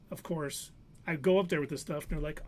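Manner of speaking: ordinary delivery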